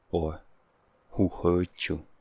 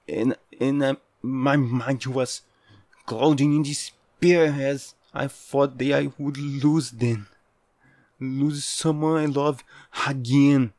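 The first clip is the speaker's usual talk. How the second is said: rising, trembling despair; 7 semitones higher, 5 dB louder than usual. Acted. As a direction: voice climbs in panic. Rival confesses fear of losing loved one.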